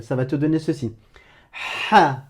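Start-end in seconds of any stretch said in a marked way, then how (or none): 1.9-2.2 "Ha" with a raspy, constricted breath from the throat; Arabic ḥa sound. Shown as a demonstration. Teacher demonstrating "Ha" with a scraped throat. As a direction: breathe out "Ha" through a tightened throat. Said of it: "je vais te prononcer ce son".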